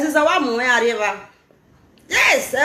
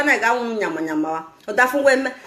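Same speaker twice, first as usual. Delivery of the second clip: usual speaking voice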